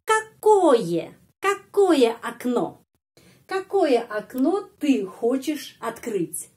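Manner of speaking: Какое high and loud, rest slow; stress on Какое. Shown as a demonstration. Teacher exaggerating the stress on Какое. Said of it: "penekanan intonasi".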